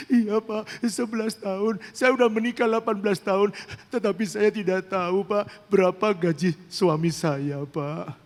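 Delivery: imitated tearful wail, high-pitched; sobbing, choking. Wife confessing to her pastor about her husband's salary, imitated sobbing. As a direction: wail it through hiccuping mock sobs. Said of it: "tersedak-sedak begitu ya".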